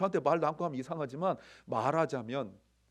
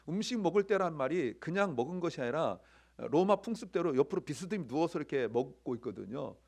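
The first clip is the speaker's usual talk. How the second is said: ordinary talk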